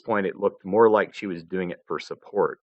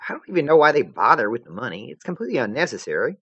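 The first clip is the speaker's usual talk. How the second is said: put-on quick bright girl voice; chatty young girl, brisk; Jade voice; 6 semitones higher, 4 dB louder, faster than usual.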